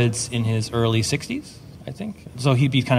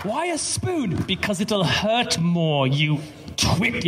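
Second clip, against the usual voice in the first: slow, theatrical British villain impression; sneering villain with random emphasis; Alan Rickman; 6 semitones higher, much slower than usual. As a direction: swoop up high, then draw out each word. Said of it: "that's the voice I do for Joker".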